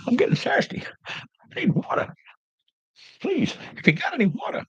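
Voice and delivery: very high, strained thirsty voice; hammed-up dying-of-thirst plea; parched, begging for water. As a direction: beg for water in a thin, strangled squeal.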